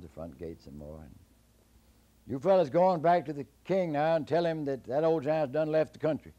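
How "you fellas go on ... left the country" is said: raised, louder call; louder dismissal. Acted. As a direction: send the soldiers off. Jack dismisses imagined soldiers.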